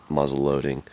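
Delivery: ordinary delivery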